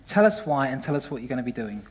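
ordinary talk

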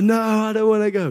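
high, loud petulant refusal; mock-sulky Moses digging in his heels; whiny Moses. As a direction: whine it back like a stubborn child. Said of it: "And he's like".

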